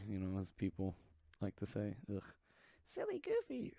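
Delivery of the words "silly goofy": much higher squeaky mock voice. Mocking the friends who call her goofy, squeaky. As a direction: squeak it out like a cartoon teasing friends.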